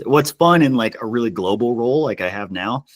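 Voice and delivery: normal talking voice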